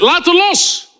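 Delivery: commanding preacher's shout; forceful command. Retold or imitated. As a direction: bark the order to let go. Wigglesworth repeats command, woman refuses.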